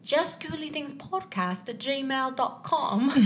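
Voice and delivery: slow, laughing British accent attempt. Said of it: "can I do this in a British accent?".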